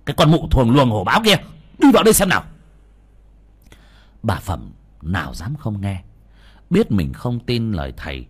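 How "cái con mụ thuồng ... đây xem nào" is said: loud, much higher, fast enraged yell; angry snarl. A narrator's reading. Young master yells at the greedy woman to come in, enraged. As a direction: spit the insult out in rage. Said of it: "mà rít lên".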